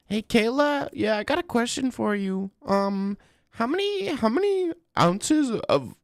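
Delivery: high squeaky mock interviewer calling out a question; mock reporter voice.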